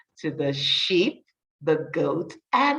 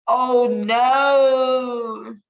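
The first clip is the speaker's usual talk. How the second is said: a higher, louder oh no of alarm; mock alarm; 5 semitones higher, 5 dB louder than usual. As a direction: gasp it out as if the knot gave way. Story reading, dramatic moment.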